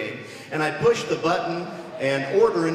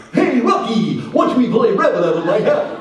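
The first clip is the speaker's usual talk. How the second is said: loud, slightly higher cartoon moose; Bullwinkle voice; 4 semitones higher, 7 dB louder than usual. A kid imitating Saturday morning cartoons at home, dopey cartoon moose. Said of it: "sounded like".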